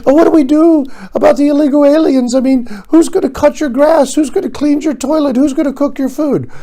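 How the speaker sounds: high, prissy mock of a rich guest; mocking rich liberal. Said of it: "I like it when they start talking about".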